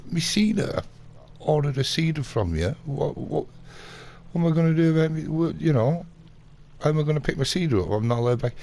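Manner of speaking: high, loud, flustered retold complaint; exasperated protest. Banned customer demands his ordered seeder, exasperated. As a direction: act out pleading your case indignantly. Acted quarrel over the seeder.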